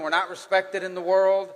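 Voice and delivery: ordinary talk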